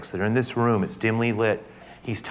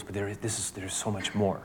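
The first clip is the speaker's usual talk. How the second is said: much softer, confiding; hushed assurance; 7 dB quieter than usual. Acted. Jesus assuring disciples of more, softly. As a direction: drop to a near-hush to reassure.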